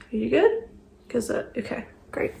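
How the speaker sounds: breathy whispered aside; hushed aside, barely voiced. Real, not acted. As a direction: whisper it so no one hears.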